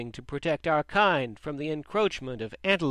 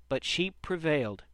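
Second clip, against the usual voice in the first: plain reading voice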